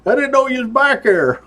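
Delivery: a hint of sheepish biker apologizing; sheepish rider. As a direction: play the caught rider meekly. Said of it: "He's like".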